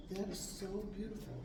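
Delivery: normal talking voice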